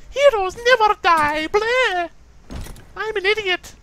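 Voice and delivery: very high, slow mocking heroine voice; Mercy. Gamer showing off an impression of a healer, mocking high voice. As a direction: ham up a squeaky, silly parody. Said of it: "Here's Mercy".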